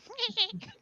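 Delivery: high-pitched demonstration cackle; imitated own cackle. Shown as a demonstration. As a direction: mimic your own uncontrollable cackling. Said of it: "all you hear is, like, me going".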